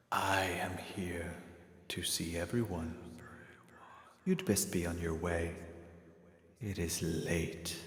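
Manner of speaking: soft, slow, half-whispered stranger; quiet, slow, breathy-free whispered calm; mysterious hooded stranger. Acted. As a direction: hush it like a figure in the dark. Stranger answers.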